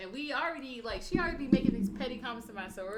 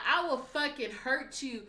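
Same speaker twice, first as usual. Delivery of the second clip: slow, raised-voice mock threat; louder, higher re-enacted warning; retold threat; 5 semitones higher, 5 dB louder, slower than usual. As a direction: act out a heated threat, drawn out. Retold threat in street quarrel.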